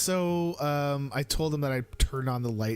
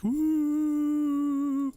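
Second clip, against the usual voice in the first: high falsetto wailing hoot; imitated wail; 4 dB louder than usual. Dad imitating his son's high wail. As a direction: wail it high like a crying kid. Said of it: "but, like, wailing".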